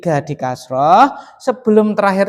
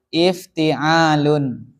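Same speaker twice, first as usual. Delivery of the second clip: "Ifti'alun" drawn out syllable by syllable; stretched "Ifti'alun" with a long middle vowel; slow model answer; 5 semitones lower, much slower than usual. Shown as a demonstration. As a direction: sound out "Ifti'alun" very slowly. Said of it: "Apa kaidahnya tambahi?".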